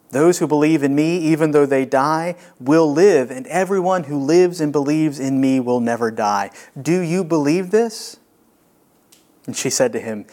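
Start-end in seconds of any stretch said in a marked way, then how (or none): 0.1-8.2 louder, rising preaching proclamation; proclaiming Jesus. Acted. Jesus declaring to Martha, loud and building. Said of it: "And Jesus said to her".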